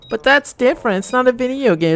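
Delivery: put-on high voice of someone protesting; mock objector. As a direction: imitate a complainer in a high, far lighter voice. Voices an objector to his point.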